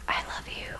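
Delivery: very soft, breath-only reassurance; tender whisper. Acted. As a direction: whisper it lovingly as God bending close. God's tender words, voiced by speaker.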